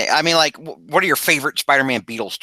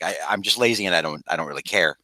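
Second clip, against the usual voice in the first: low, offhand self-imitation; imitating own deadpan; 6 semitones lower, 4 dB quieter than usual. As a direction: replay your own careless deadpan, dropping lower. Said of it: "I realized how I sound sometimes".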